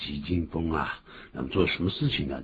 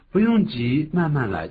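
mild reassurance; 9 semitones higher, 4 dB louder than usual. Acted. Voicing reassuring phrase.

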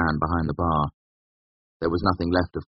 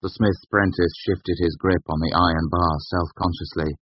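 ordinary delivery, nothing marked

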